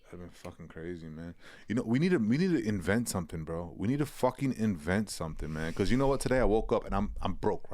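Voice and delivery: ordinary delivery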